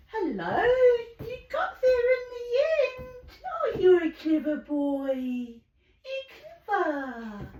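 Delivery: high, crying-out delight; gushing praise. Real, not acted. Owner gushing over her bird that went to bed, high and excited. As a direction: burst out praising the clever bird.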